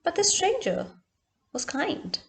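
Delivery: usual speaking voice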